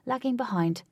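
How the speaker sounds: plain reading voice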